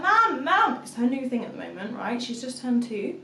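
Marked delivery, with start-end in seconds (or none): 0.0-0.8 high, loud repeated call; imitated toddler yell. Two-year-old calling mum at night, high insistent yell. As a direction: mimic a toddler demanding Mum.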